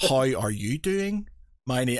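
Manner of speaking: imitating a slow, syllable-by-syllable Irish twang; slow, careful English-teacher diction; over-enunciated syllables. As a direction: mimic a friend enunciating every syllable. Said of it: "every syllable is enunciated".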